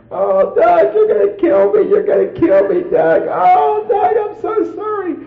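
high, loud hysterical wail; imitating a screaming, sobbing apology; mock bawling and screaming. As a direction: wail it like a hammed-up frantic wife. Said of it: "bawling and screaming".

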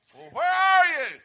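very loud, high, drawn-out holler; hammed-up searching shout; calling out. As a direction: bellow it high and slow. Wife voiced searching for him.

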